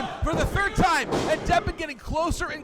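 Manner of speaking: high loud rising call; excited commentator shout. Real, not acted. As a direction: build up the near fall. Near fall climax.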